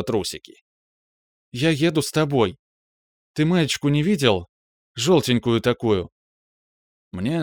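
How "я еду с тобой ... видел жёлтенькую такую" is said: put-on raised, gentle girlfriend voice; young woman, tender and earnest. A narrator's reading. Girlfriend comforting her upset man, tenderly.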